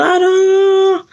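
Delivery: long high-pitched wail, imitated; imitated crying. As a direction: draw out a mimicked tearful moan. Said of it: "And she was going".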